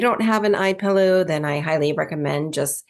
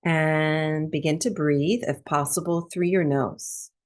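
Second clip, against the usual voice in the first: calm, measured breathwork instruction; guided meditation. Real, not acted. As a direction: ease listeners into stillness, phrase by spacious phrase. Leads breathwork meditation after intro.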